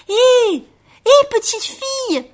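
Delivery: very high, loud little-bear shout; Baby Bear calling out. A narrator's reading. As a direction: holler after someone running away. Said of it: "lui cria bébé ours". (cri aigu de bébé ours qui appelle)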